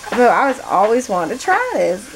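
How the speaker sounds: ordinary talk, nothing marked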